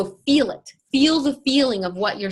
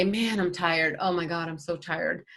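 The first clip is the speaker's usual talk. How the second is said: mock tired moan; 4 semitones lower, 5 dB quieter than usual. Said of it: "if I say".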